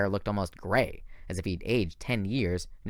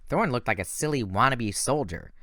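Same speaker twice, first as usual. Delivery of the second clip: plain reading voice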